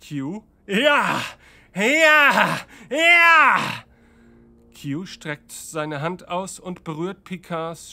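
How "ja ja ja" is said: shouts climbing ever higher; triumphant escalating yell; shouting. Acted. Q answers Picard's screams.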